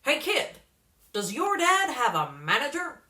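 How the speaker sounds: put-on loud, pushy hustler calling a kid over; a slick manager leaning in with a sales pitch; brash showbiz manager. A narrator's reading. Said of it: "up and said".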